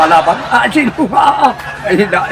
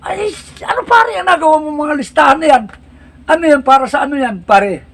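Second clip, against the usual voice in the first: higher, prying buddy's voice; nosy barkada; 9 semitones higher than usual. Acted. Friend at the corner questioning the one with the list, high and nosy. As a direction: quiz your friend about the paper.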